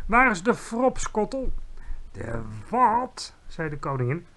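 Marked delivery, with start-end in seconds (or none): 0.1-1.5 higher, louder demand; indignant call. A narrator's reading. Giant demands his favourite drink, calling out. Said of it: "riep de G V R". 2.1-3.3 low start, puzzled question; baffled query. A narrator's reading. Queen hears a strange word, baffled.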